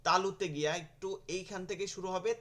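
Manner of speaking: usual speaking voice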